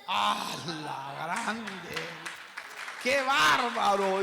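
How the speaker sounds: a loud, astonished exclamation; stunned outburst. Real, not acted. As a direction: burst out in disbelief. Overwhelmed by the Spirit's command.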